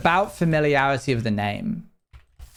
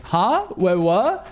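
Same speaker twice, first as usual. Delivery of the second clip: mock-baffled, higher-pitched double take; 8 semitones higher, 4 dB louder, much slower than usual. Streamer reacting to a lurker's first message, mock-baffled. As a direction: act out a puzzled high "huh?". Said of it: "we get to do the whole, like".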